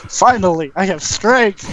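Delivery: high-pitched triumphant declaration; mock triumph. Game master voicing a newly strong character, mock triumph.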